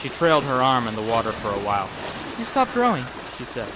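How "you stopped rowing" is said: put-on high, sulky little girl; young girl voice. A narrator's reading. Lex brushing off the danger to Grant, high girl voice. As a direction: pout it like a stubborn kid.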